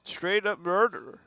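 imitated TV doctor holding forth; mock-earnest TV counselor impression; Doctor Phil. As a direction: put on a talk-show doctor voice. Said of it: "a half a Doctor Phil".

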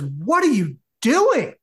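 high, slow mock outrage; outraged demand. Woman to her date reaching over with a napkin, acted outrage. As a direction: imitate an appalled date, stretching each word.

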